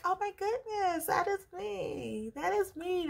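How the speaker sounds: high, thrilled squeal of recognition; excited. Retold or imitated. Reading the article, realizing she qualifies, excited and high. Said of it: "I'm so excited".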